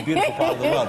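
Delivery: giggling through the line; laughing through words. Real, not acted. Host laughing at own joke.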